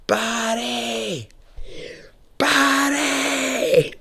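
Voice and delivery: high, drawn-out calls of a name across the streets; calling out. Retold or imitated. Friend searching snowy streets, calling out his lost mate's name. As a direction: call the name out long and high. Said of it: "just shouting".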